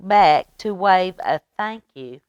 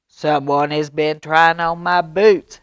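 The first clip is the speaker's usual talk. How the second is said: louder booming bear voice; big bear voice; 8 dB louder than usual. Acted. Papa Bear finding his boots moved, booming loudly. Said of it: "he boomed in his big bear voice".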